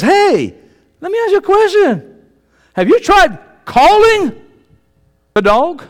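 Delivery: high, loud, pointed question; incredulous neighbor. Acted. Neighbor asks dog owner why he hasn't called, incredulous. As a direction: ask as if the answer were obvious.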